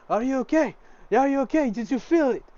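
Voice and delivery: high, loud, anxious questions; alarmed and eager all at once; urgent concern. Acted. Rushing to the freed girl.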